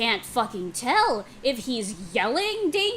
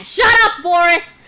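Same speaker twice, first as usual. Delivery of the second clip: angry shouted scolding, high and loud; öfkeli azar; 6 semitones higher, 13 dB louder than usual. Acted. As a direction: yell it as a furious telling-off. Acted scolding of Boris in comic.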